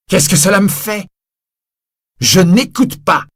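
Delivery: loud high contempt; scornful shout. Acted. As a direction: fling the refusal at him. Javert scornfully refusing.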